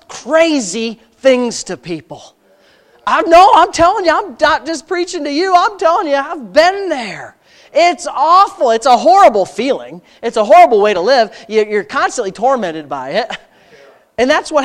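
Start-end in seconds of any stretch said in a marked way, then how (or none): 3.1-11.4 loud, high testimony; preaching at full force. Acted. Preacher confessing his own anger to the congregation, loud. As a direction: pour it out from the pulpit.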